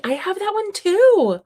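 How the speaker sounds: high, loud, stretched-out exclamation; excited squeal. Real, not acted. To a fellow reader about a shared book, excited squeal. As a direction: squeal it out, thrilled at the match.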